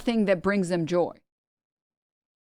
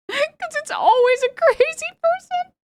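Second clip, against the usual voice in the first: squeaky mock voice; 3 dB louder than usual. Mocking schizo posters, high put-on voice.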